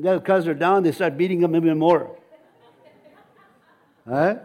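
ordinary talk

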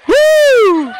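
very high, sustained "woo"; long high whoop. Real, not acted. Preacher stirring up the church, long piercing whoop.

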